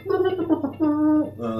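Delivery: high squeal of dismay; sudden high oh; surprised oh. Real, not acted. Reacts to something going wrong.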